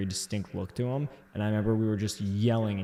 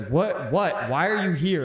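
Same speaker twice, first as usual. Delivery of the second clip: higher, louder confused demands; incredulous roommate; 7 semitones higher, 5 dB louder, slower than usual. Retold or imitated. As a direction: snap like a baffled host. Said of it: "He was just like".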